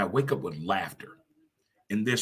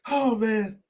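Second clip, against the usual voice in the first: drawn-out high squealing laughter, then a sigh; long high laugh; 10 semitones higher than usual. Real, not acted. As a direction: laugh till breathless. Long laugh after coffee joke.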